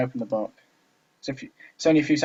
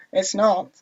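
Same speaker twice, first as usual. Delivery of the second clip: higher voice sliding back into American; pitched-up "not" with an American vowel; accidental American accent; 7 semitones higher, 5 dB louder than usual. Acted. Another retake of Natsuki's denial, slipping into an American accent. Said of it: "stop doing an American accent".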